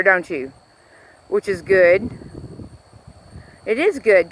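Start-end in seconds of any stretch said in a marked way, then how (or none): none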